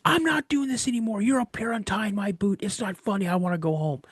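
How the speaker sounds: put-on high, strained, protesting kid voice; retold freak-out. Kid protesting to dad about untied boot, retold freak-out. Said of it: "he could see that I'm freaked out".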